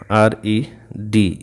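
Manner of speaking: RED spelled out letter by letter, very slowly; slow spelling. Shown as a demonstration. Teacher spelling red for students before doubling the D, very slow. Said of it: "इसी को double करना".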